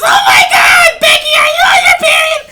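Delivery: put-on shrieking high frantic girl voice; mock a hysterical friend screaming; panicked friend. Friend shrieking at bleeding girl in panic. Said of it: "Comes out and says".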